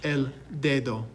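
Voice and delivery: dedo said DAY-doh, softly and evenly; Spanish pronunciation. Shown as a demonstration. Teacher has students repeat the word for finger, soft demonstration. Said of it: "Repeat after me".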